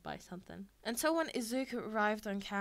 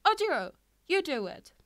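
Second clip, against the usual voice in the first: high, loud nervous order; panicked plea; 7 semitones higher, 10 dB louder than usual. A narrator's reading. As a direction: shove the job onto Ojiro. Classmate pushing task away.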